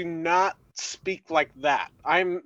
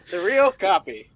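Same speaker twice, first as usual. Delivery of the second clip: mock teen slang; 4 dB louder than usual. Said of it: "However you guys talk".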